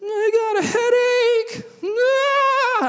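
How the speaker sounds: put-on very high, drawn-out whiny kid; Dana. Seventh grader complaining to camp counselor, high mock whine. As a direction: wail it slowly like a complaining child. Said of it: "Dana comes up, and he's like".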